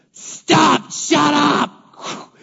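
high, loud command to the storm; commanding shout. Acted. Jesus silencing the storm, loud and high. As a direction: bark it at the wind.

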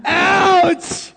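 high-pitched comic yelp of pain; mock pained cry. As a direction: cry out as if being operated on. Acts out pain of God's surgery.

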